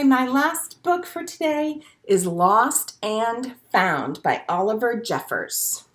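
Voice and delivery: plain reading voice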